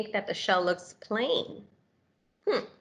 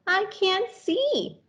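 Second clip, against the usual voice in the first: slow, squeaky complaint; 11 semitones higher, much slower than usual. Acted. Snail lost in dark forest.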